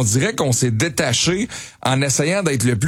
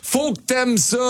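mock insistent; 11 semitones higher, slower than usual. Quoting pushy cultural critics.

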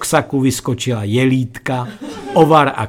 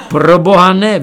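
higher, louder refusal; crying out; 4 semitones higher, 5 dB louder than usual. A narrator's reading. Nephew refusing aunt's food, crying out. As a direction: exclaim in mock horror. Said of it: "vykřikl jsem".

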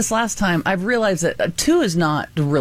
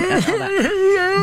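teary laugh; much slower than usual. Real, not acted. Laughing through tears about crying.

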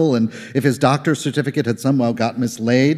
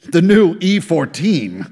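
talking through a mouthful of schnitzel; mouth full; 7 semitones higher, slower than usual. Acted. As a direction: say it while chewing. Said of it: "he said with his mouth full".

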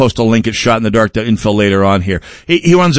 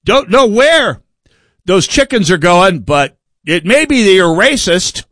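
shifty fox; 7 semitones higher than usual. Retold or imitated. Fox watchdog denying the missing chickens, high and wide-eyed innocent. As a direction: protest innocence like a guilty fox. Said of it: "your fox watchdog says".

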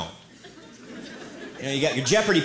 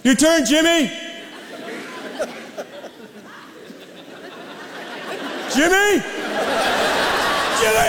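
calling out; 12 semitones higher than usual. Acted. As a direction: holler for the kid to throw. Calling a kid outside.

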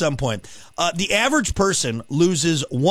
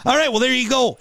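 usual speaking voice

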